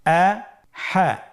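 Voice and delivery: "আহা" very slowly in two separate syllables; syllables apart. Shown as a demonstration. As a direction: split "আহা" with a clear pause between syllables. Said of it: "এভাবেও পড়তে পারেন". ("আহা" ভেঙে ভেঙে ধীরে)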